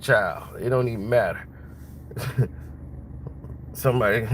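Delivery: ordinary talk, nothing marked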